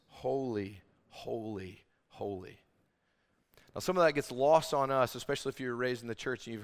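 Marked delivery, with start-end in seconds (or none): 0.1-2.6 softer with each holy, fading away; hushed reverent. Acted. Preacher voicing the angels, fading to a hush. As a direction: let the word sink quieter each time. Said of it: "They say this".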